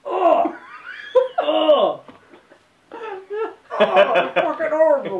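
high, loud gagging oh; disgusted cries. Real, not acted. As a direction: cry out in revulsion. Retching at a rotten bean.

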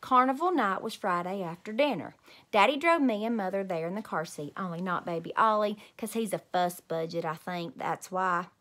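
ordinary delivery

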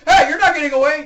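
loud, fast, high yell; hurried hammed-up threat; mock chasing shout. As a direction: holler after someone escaping. Shouting after fleeing witness.

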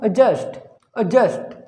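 "एडजस्ट" as uh-just; "एडजस्ट" with a light schwa, no d; silent d. Shown as a demonstration. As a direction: drop the d and weaken the start of "एडजस्ट". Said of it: "डी का sound तो आता भी नहीं".

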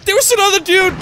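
high, startled exclamation; alarmed. Real, not acted. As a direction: blurt it out in alarm. Spots another figure in game.

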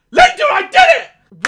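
high loud cry of triumph; triumphant shout. Real, not acted. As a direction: celebrate getting the word out. Triumph at saying the word.

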